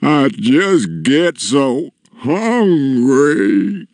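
miserable, slow giant voice; tearful giant. A narrator's reading. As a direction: wail it slowly as a giant. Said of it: "a giant tear rolled down".

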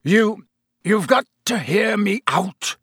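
high, strained, desperate croak; halting plea forced out of a tight throat. A narrator's reading. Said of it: "he croaked".

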